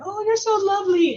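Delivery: high, doting gush; voicing Catwoman. Catwoman greeting a fan at the autograph line, doting. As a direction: coo it at a fan.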